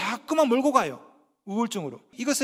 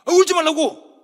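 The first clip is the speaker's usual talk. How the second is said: loud, high exasperated shout; exasperated parent; 6 semitones higher, 9 dB louder than usual. Acted. Korean parent as the child cries harder, exasperated shout. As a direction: yell it as a parent losing patience. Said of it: "그 소리에 놀라서 더 울죠".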